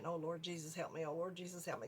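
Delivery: low, soft, hurried retold prayer; hushed, repeated plea, pitched low; praying. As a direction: pray under your breath to calm yourself.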